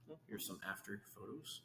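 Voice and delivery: usual speaking voice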